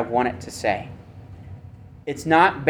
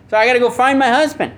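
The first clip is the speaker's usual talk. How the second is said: higher, faster voice of a pushy wife; Jezebel voiced; 9 semitones higher, 3 dB louder than usual. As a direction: play the wife, quick and high, fussing at her husband. Preacher voices Jezebel to Ahab.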